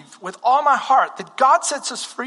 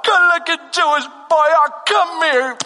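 high New York deli lady, stretched out; New York accent; 9 semitones higher, 5 dB louder than usual. Retold or imitated. Deli lady beckoning a visitor, gushing New York accent. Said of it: "in this New York accent".

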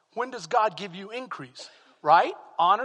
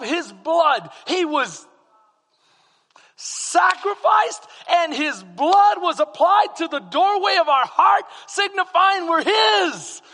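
loud, high, drawn-out proclamation; full-force preaching; 8 semitones higher, 9 dB louder, slower than usual. Real, not acted. Pastor proclaiming redemption to his congregation, full-force preaching. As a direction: preach it out, stretching the big words.

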